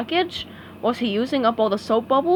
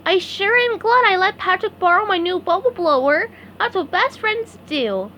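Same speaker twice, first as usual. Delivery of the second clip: SpongeBob voice; 8 semitones higher than usual. A narrator's reading. SpongeBob talking to Gary.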